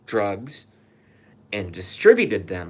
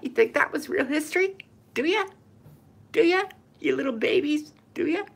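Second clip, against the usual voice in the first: much higher, sing-song mocking voice; mocking taunt; faster than usual. Ranter mocks viewers who trust school history, high taunting voice. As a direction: taunt them like silly children.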